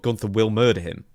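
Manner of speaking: normal talking voice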